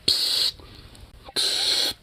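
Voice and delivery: ordinary talk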